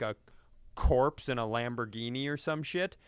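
ordinary talk, nothing marked

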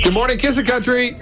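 high, bright DJ phone greeting; radio contest promo. Acted. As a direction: answer the contest line with energy. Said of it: "you gotta be screaming".

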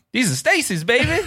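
high suave shoe rep call-out; smooth salesman yell. Retold or imitated. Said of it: "he yelled at me".

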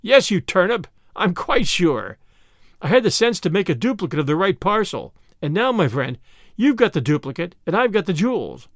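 usual speaking voice

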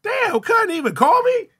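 mock hurt surprise. Streamer retelling his hurt when dad skipped his house. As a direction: play up the sting of being left out. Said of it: "I said".

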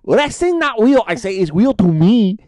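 high, loud put-on Asuka voice with broken English; Asuka impression. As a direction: play the wrestler who thinks it's all real. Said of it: "Her name is Asuka".

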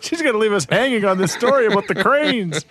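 high-pitched, hammed-up protest; mock despair. Host crying out to vanished co-host mid-story, mock despair.

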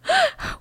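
high shocked gasp; a quick stunned intake of breath; real gasp of disbelief; sharp gasp. Real, not acted. Shocked reaction to the answer.

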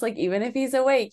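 ordinary delivery

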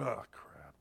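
very low, hushed whisper; whispered dismay. Retold or imitated. Father seeing his son cry in the stands, whispered dismay. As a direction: whisper it under your breath, dismayed.

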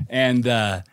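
ordinary talk

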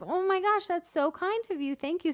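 high, delighted gush; gushing thanks. Retold or imitated. Walker thanking the boys for lemonade, high gushing delight. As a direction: gush like you're touched.

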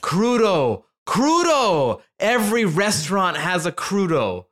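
high, drawn-out exasperated cry; exasperated rant. Real, not acted. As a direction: cry out the dish name in comic exasperation. Exasperated rant about crudo everywhere.

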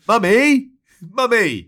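put-on high loud child calling. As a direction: call out like a tattling kid. Mock child calling mum.

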